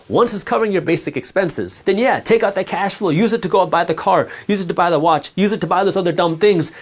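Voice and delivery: ordinary talk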